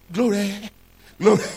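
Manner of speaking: high, loud rote praise word; mock religious. Preacher showing how one can say it religiously, mock religious. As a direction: cry it out religiously, for show. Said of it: "You can even say religiously".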